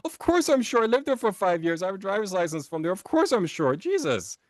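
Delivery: very high, spluttering indignant outburst; mock exasperation. Customer insists to doubting clerk he lived there, acted exasperation.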